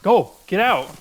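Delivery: loud frantic yell. Real, not acted. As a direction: shout them away, high and loud. Fighting off attacking wolves.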